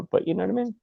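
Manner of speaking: ordinary talk, nothing marked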